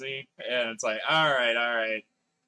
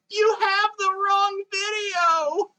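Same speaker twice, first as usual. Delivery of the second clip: meme voice; 6 dB louder, much slower than usual. Acted. Meme quote shouted high.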